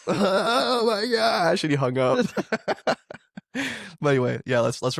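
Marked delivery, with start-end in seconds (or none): 0.1-1.6 high, very slow hammed-up wail; mock wail. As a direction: drag it out in fake horror. Retold prank call, wailing.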